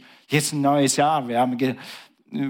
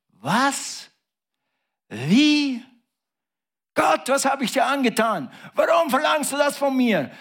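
anguished protest; 10 semitones higher than usual. Acted. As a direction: wail it like a wronged man. Abraham voiced in anguished protest.

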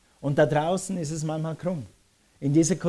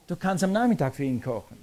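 usual speaking voice

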